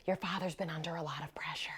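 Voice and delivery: put-on hushed, secretive whisper to the children. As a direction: lean in and whisper the punchline. Mother tells children, punchline.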